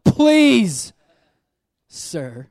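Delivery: a polite protest with bite; indignant. Shown as a demonstration. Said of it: "I read this with a bit of an indignant tone".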